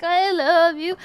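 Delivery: playful mock drunken declaration; tipsy brother. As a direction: gush it loudly as a drunk brother would. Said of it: "My brothers have too much fun on the weekend".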